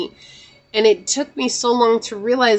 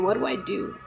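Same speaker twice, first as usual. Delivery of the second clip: softly asked plea; 8 dB quieter than usual. Retold or imitated. Grieving daughter asking for guidance, softly. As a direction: ask it quietly, almost under your breath. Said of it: "I remember asking".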